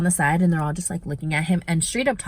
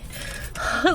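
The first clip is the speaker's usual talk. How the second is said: breathy laugh; 6 semitones higher, 4 dB quieter than usual. Real, not acted. Laughs at boyfriend's reply.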